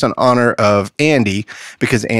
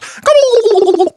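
high, rapid turkey gobble; 3 dB louder than usual. Acted. Joke teller voices the turkey at heaven's gate, gobbling. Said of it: "turkey looks at him and goes".

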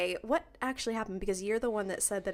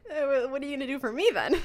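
laughing mock question; 5 semitones higher, 5 dB louder, much faster than usual. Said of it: "we were like".

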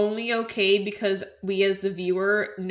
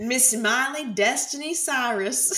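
slow, grand, showy name call; mock announcer; 4 semitones higher, slower than usual. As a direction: roll out the full name.

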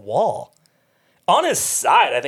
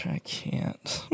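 barely voiced giggly I can't; hushed helpless whisper; whispered refusal; 12 dB quieter than usual. Real, not acted. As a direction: whisper it through suppressed laughter. Refusing to focus.

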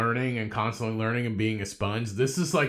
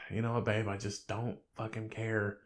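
soft dismissive; 8 dB quieter than usual. Retold or imitated. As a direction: tell her gently you don't care. Retold reply to girlfriend.

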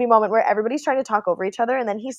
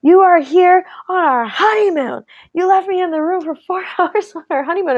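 high-pitched, indignant retold telling-off; indignant scolding; 9 semitones higher than usual. Upset bride confronting her husband on their honeymoon, indignant. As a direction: imitate an outraged wife, pitched way up. Acted quarrel of an upset wife.

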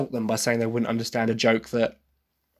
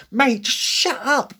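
high, slow, sassy shut up; mock teen sass, much higher; teen attitude; 12 semitones higher, slower than usual. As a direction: brush someone off with teen attitude. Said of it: "her Anne had such an attitude".

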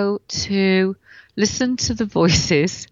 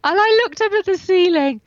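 raised pitch, loud retelling of the shock; exclaiming; 12 semitones higher, 5 dB louder than usual. Retold or imitated. Telling the host about the crack, high and loud. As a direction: cry out the moment it cracked. Retelling the spine fracture moment.

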